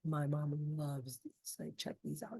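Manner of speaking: hushed secretive whisper; imitating a quiet introvert's whisper. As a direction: lean in and whisper the pitch. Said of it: "he would be like".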